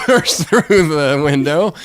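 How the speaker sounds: usual speaking voice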